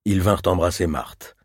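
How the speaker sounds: usual speaking voice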